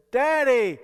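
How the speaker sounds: calling out. Retold or imitated. Deep breath, calling father.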